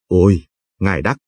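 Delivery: understated near-tearful exclamation; a touch of whimpering relief; tearful cry. A narrator's reading. Old man in the hammock greeting Đắc, mildly tearful cry. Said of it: "một tiếng kêu như mếu".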